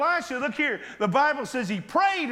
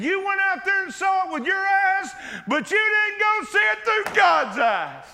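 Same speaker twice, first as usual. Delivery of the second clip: higher, louder preached shout; fervent rebuke; 8 semitones higher, 4 dB louder than usual. Acted. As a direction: hammer the point home to the servant. Elisha rebuking servant, preached.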